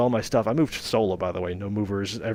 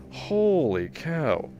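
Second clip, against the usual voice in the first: amazed; 6 semitones higher, much slower than usual. Real, not acted. Reacting to biggest donation ever.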